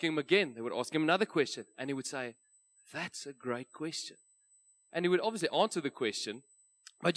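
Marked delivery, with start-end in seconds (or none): 2.9-4.2 softly spoken mock-British; hushed, put-on British accent. Pitcher answering another investor question, British accent. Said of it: "my best British accent".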